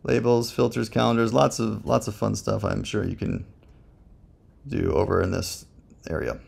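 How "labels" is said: ordinary talk, nothing marked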